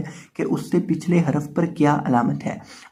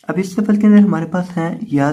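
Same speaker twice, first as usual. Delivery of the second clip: ordinary talk, nothing marked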